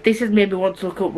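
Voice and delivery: ordinary talk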